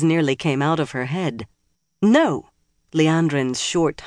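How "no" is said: sharp cry of no. A narrator's reading. Sudden shocked protest.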